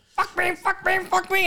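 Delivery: put-on very high squealing demon girl; possessed girl voice. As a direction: shriek it like a possessed child. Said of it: "and she goes".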